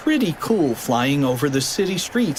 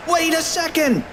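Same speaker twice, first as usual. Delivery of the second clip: high-pitched cartoon kid calling out; sudden squeaky urgency; urgent cry. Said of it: "cried Fluffy".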